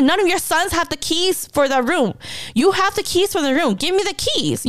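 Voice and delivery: heated retelling without shouting; retold angry demand. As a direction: press dad for an answer, pitch riding high. Retold confrontation demanding keys.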